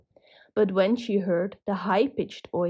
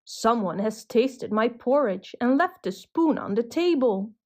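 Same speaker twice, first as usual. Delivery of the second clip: plain reading voice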